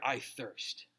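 "I thirst" said low and soft, parched and weak; quiet low I thirst. Shown as a demonstration. Pastor voicing Jesus on the cross, soft and parched. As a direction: let "I thirst" sink, soft and dry. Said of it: "Why did he declare".

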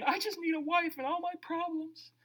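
light mock whine of a young man. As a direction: parody a man who wants a fix. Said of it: "Young men".

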